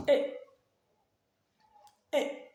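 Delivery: "E" as "ee", cut very short; brief, abrupt "E"; fourth tone. Shown as a demonstration. Teacher drills the brief tone on the vowel, "E" cut short. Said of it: "il est grave et bref".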